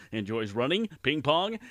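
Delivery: ordinary talk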